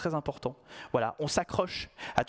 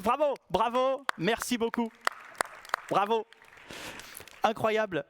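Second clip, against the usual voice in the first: applaudissements ironiques, voix plus haute; félicite pour se moquer; sarcastic bravo; 6 semitones higher than usual. Pretend. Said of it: "Ça mérite un petit applaudissement". (bravo sarcastique, bien appuyé)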